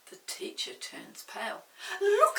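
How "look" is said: high loud eager call; excited children. Acted. Children pointing at the snail, high excited call. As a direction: call out like thrilled kids. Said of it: "say the children".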